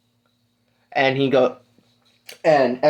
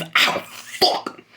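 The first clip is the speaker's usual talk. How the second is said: real pain; 4 dB quieter than usual. Real, not acted. Speaker hurts themselves.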